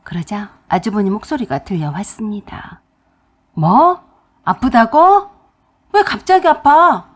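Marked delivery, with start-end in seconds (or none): 3.5-7.0 loud, higher, startled questions; surprised. Retold or imitated. Brother hearing his wife collapsed, loud and startled. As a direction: sound caught off guard.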